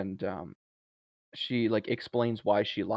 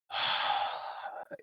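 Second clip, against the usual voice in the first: long, breathy exasperated sigh; audible groan; 8 dB quieter than usual. Retold or imitated. Jordan reacting to the podcast's name, audible groan. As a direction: let out a soft, drawn-out huff. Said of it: "audibly goes".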